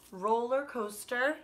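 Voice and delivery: usual speaking voice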